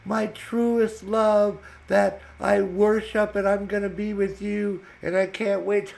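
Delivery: high, loud, slow mock swooning lady; gushing lovestruck woman. Scammer texting her undying love, high and swooning. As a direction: ham up a smitten woman's love talk.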